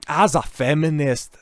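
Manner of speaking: a hint of feminist caricature; mock feminist. First take, before updating the accent.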